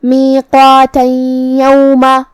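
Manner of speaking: میقاتا یوما joined, tanween merged into یا with nasal ghunna; slow chanted merge of میقاتا یوما; idgham demo. Shown as a demonstration. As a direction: slide میقاتا straight into یوما, nasalised and doubled. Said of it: "تنوین کو یا میں ملا کے غنہ اور تشدید".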